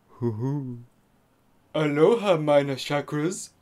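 low, slow new-age chant; mystical healer. Acted. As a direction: intone it like a mystic.

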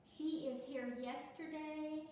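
slow, deliberate read, leaning on she; slow read of the mistake. Shown as a demonstration. Teacher rereading the uncorrected sentence for the class, slow and deliberate. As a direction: read the wrong sentence out slowly so they hear the error. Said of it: "Doesn't make sense, does it?".

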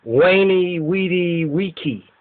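WENI, WEEDY, WIKI with W sounds and hard K; classical W pronunciation. Shown as a demonstration. Lecturer quoting Caesar to students, classical W pronunciation. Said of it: "which would be more correctly pronounced".

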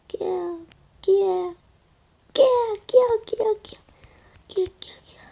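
high excited squealing; excited squeals. Real, not acted. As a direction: squeal with glee. Squealing at game action.

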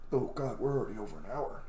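ordinary talk

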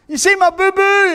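high, whining complaint about a boo-boo; mock self-pity, high and far from his own voice; whiny hurt believer. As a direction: whimper it like a wounded child. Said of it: "And I".

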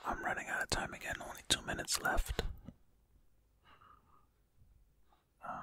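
soft breathy whisper to the mic; hushed running commentary; ASMR whisper. Acted. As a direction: whisper close and intimate while solving. Whispered ASMR puzzle play.

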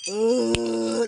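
loud groaning grunts. Real, not acted. Healer grunting loudly over the patient in trance. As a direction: push out each grunt from the chest.

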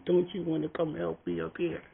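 high, soft mock plea; pleading performer. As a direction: coax a helper from the stage, high and softly. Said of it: "the guy on stage is going".

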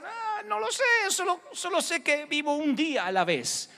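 high, easy and carefree; voiced believer. Believer answering the skeptic, high and carefree. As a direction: float it up high, unworried.

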